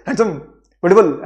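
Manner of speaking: Handsome and beautiful hurled loud, each in one burst; mock insult, handsome and beautiful run together; no pause, like abuse. Shown as a demonstration. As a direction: squash handsome, beautiful as if swearing at someone. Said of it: "गाली दे रहे हो".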